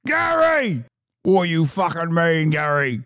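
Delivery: a lighter touch of the outraged bloke; half-hearted jab of the ranting voice; mock gammon. Streamer briefly back in the gammon voice at Gary. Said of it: "once I said gammon, it was over".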